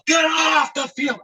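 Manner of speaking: high, slow, stretched holler; mock groundskeeper shout; imitated yell. Yell heard again by sleeper.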